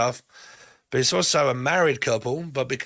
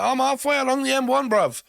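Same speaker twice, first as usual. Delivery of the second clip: a hammed-up bruv voice, pitched up; cheeky geezer; 11 semitones higher than usual. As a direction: play the flaky mate, bright and breezy. Voicing a flaky partner.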